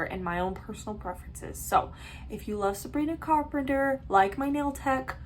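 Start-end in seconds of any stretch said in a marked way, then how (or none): none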